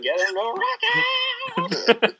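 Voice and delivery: slow build to a high, drawn-out yell; mock rocker yell. Co-host joking about waking the sleeping brother, hammed-up rocker yell. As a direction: rise into a long rock-show holler on the last words.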